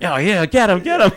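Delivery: mock crowd shouting get him; imitated crowd cheer. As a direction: yell it like a kid ringside.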